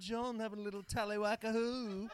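high, loud comic Liverpudlian voice; Liverpudlian accent. Acted. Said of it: "you did the Liverpudlian".

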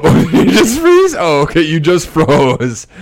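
high, loud, slow startled laugh; startled as the call freezes; surprised laugh. Real, not acted. Video call freezes suddenly.

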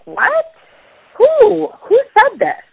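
high-pitched, incredulous questions; retold shock, pitch leaping up on each question; shocked demand. Mother asking her son who said it, shocked. Mother reacts to son's report.

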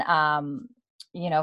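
ordinary delivery

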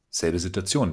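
normal talking voice

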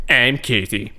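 slow, low lightly voiced DJ; drawn-out radio host intro; Casey Kasem. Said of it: "like a Casey Kasem".

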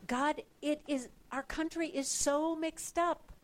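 loud, exasperated cry to God; imagined prayer. Pretend. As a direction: raise your voice in a frustrated prayer. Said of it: "got on our knees and we said".

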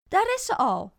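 high, loud call to her back. Acted. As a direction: shout it after her as she walks away. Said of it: "roep ik naar de rug van Elsa". (hoog en luid geroepen)

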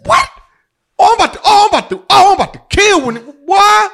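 loud, high cocky shouting; hyped up. Retold or imitated. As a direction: psych yourself up at full volume. Hyping himself up to impress Denzel.

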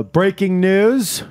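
high, slow, drawn-out announcement; stretched-out mock bulletin intro; news bulletin announcer. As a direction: announce it as a cue for a sound effect. Cue for a sound effect.

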